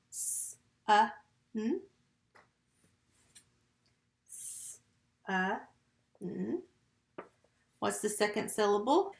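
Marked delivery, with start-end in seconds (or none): 0.1-1.8 "S, uh, n" as three separate sounds; sounded out. Shown as a demonstration. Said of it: "Tap sun". 4.3-6.6 "S, uh, n" slowly, with pauses between the sounds; sounded out. Shown as a demonstration. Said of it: "Tap sun".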